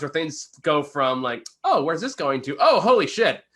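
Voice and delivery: usual speaking voice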